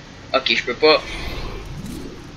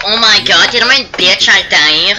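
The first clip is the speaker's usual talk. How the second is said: indignation exagérée; 4 semitones higher, 5 dB louder than usual. Retold or imitated. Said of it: "Moi, c'est comme genre".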